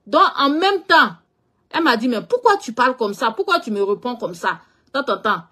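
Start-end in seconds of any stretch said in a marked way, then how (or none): none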